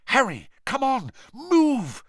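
high, urgent cry; urgent plea. A narrator's reading. Hermione urgently tugging Harry away.

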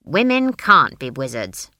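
put-on loud, firm old granny; blunt. A narrator's reading. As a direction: lay it down bluntly and forcefully. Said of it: "said Granny bluntly".

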